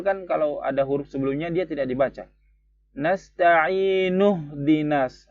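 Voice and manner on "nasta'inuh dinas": Nasta'inuh dinas slow, linked; connected reading. Shown as a demonstration. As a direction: say nuh dinas joined without a break. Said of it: "begitu bacanya". (Nasta'inuh dinas dibaca bersambung)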